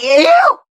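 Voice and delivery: high, loud disgusted shriek. Acted. Disgusted at being a wife.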